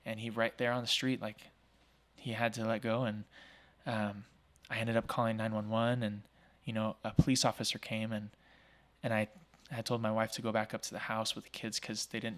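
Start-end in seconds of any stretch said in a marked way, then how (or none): none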